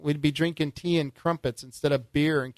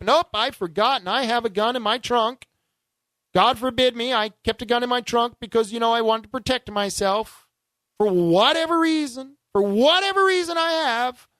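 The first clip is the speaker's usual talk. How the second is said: mock indignant gun owner; 7 semitones higher, 4 dB louder than usual. Host voices an imagined arrested gun owner.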